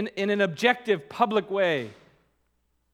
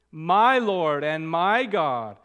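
slow, louder, My stressed; intimate confession; 4 dB louder, slower than usual. Shown as a demonstration. Preacher to congregation, slow stress on My. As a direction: say My slowly as a personal vow. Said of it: "in the most intimate sense".

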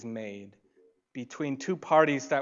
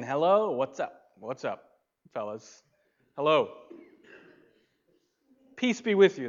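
casual greeting; 2 semitones higher, 5 dB louder than usual. Retold or imitated. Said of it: "He's just saying".

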